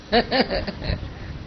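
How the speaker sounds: a quick airy chuckle; soft amused laugh; soft breathy laugh. Real, not acted. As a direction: laugh under the breath at the joke. Laughs at own punchline.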